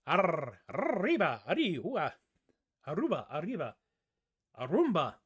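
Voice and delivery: "arriba" with a long trilled R; Spanish rolled R in "arriba". Shown as a demonstration. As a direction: ride the R in "arriba" into the sunset. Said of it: "you grab that R and you ride it off into the sunset".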